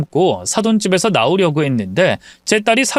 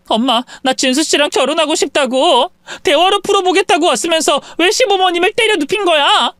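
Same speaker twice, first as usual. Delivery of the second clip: higher, protesting daughter voice; upset daughter; 12 semitones higher than usual. Acted. Daughter confronts mother over the slaps, upset.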